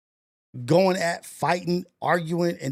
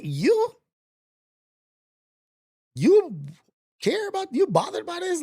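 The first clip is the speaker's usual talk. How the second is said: high, disbelieving "You?"; can't believe they care; incredulous; 11 semitones higher than usual. Real, not acted. Incredulous at people caring.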